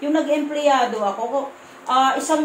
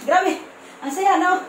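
bright, higher, delighted voice; excited; 6 semitones higher than usual. Real, not acted. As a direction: gush about the money, pitched up. Excited about the money. (masayang-masaya, mataas ang boses)

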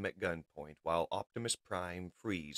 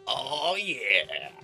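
loud, higher swaggering cheer; chuckling relish; 6 semitones higher, 7 dB louder than usual. A narrator's reading. Wheeljack arrives armed for battle, gleeful. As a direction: relish it, ready for a fight. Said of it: "Wheeljack chuckles".

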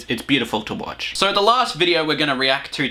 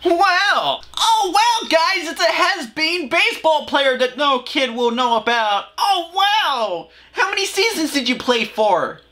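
put-on much higher, over-the-top cheery kids' host parody; mock kids' host voice; 13 semitones higher than usual. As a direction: ham up a fake-bubbly children's presenter. Mocking the children's host.

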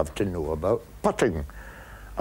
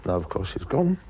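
ordinary talk, nothing marked

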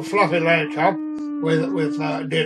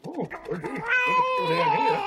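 much higher, slow falsetto of dismay; shrill fretful cry; slower than usual. Retold or imitated. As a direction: squeak it out in a high, bewildered falsetto. Narrator frets at uproar.

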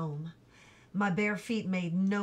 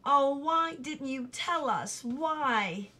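a mildly quivering, slower plea, pitched higher; a mother holding back tears as she asks; a touch of tremble, slow and raised; 5 semitones higher, slower than usual. A narrator's reading. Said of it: "in a quivering voice".

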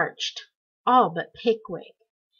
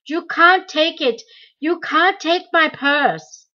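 higher, louder pleading cry, slowing at the end; desperate shouting; 7 semitones higher, 6 dB louder, slower than usual. A narrator's reading. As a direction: fight for the purse with panic. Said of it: "he kept shouting".

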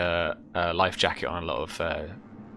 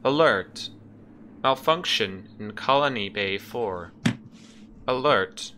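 higher, clipped announcement of a ship's alarm system; ship computer alarm; 3 semitones higher, slower than usual. Acted. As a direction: play the automated warning over the speakers. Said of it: "you can hear again more clearly now".

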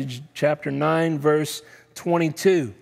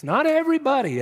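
"Not" pitched high and loud; sharp, emphatic "Not everybody"; stress on NOT; 12 semitones higher, 3 dB louder, faster than usual. Shown as a demonstration. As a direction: hit "Not" hard. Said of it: "is emphasized next".

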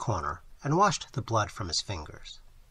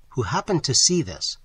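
usual speaking voice

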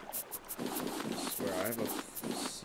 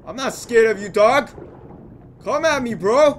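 loud, high, jeering shouts at the shark; taunting yell; 8 semitones higher, 12 dB louder than usual. Real, not acted. Gamer yelling taunts at the shark he's fighting, loud and high. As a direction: bait the shark, yelling come at me.

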